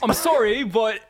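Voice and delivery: second go at British, fighting laughter; hammed-up British accent on the edge of laughing. Shown as a demonstration. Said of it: "Let me do it one more time".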